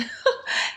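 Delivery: short unvoiced laugh; breathy laugh. Real, not acted. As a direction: let out a quick airy laugh. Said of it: "hair in my mouth".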